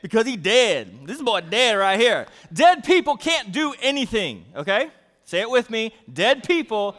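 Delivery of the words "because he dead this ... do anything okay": louder, playful deadpan; playful slang. Pretend. Pastor joking to the congregation, playful and louder. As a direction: say it with a playful shrug.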